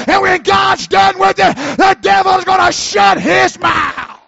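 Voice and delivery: loud, high, driving sermon delivery; full-force preaching. Acted. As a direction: preach it hard to the congregation, building.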